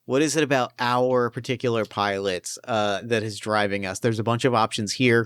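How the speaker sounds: ordinary talk, nothing marked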